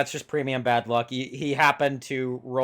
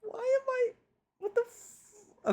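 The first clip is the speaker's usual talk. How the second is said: much higher, stunned and confused; baffled squeak; 4 dB quieter, slower than usual. Real, not acted. Cohost stunned that the player named an unfamiliar map, baffled squeak. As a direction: blurt it out in disbelief.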